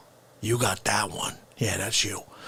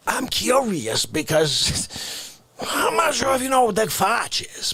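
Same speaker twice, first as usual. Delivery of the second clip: normal talking voice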